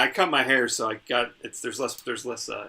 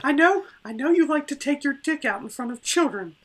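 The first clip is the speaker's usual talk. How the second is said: much higher put-on voice; silly character voice; 10 semitones higher than usual. Co-host as princess scolding Greg in a put-on voice. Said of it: "your voice went into, like, this deep".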